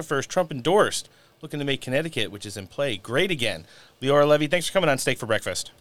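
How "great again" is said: ordinary talk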